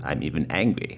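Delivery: low, soft grumble; subdued sulk. A narrator's reading. Jonah's tantrum winds down, low soft sulking. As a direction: drop it low and quiet.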